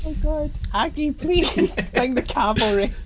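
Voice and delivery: very high, squeaky plea through laughter; laughing plea. Acted. Player begs Aggie to bring the cavalry, high laughing plea. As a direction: beg for rescue in a high, giggly voice.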